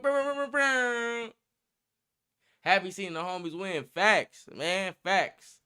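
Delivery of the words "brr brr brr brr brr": higher rolling lips buzzing horn blasts; mock air horn brr sounds; imitating air horns. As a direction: buzz like a hype air horn. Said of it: "When you hear the motherfucker".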